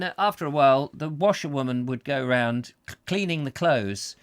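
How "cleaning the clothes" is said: ordinary talk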